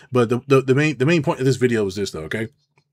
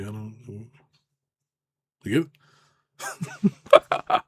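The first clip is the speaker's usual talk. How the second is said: ordinary talk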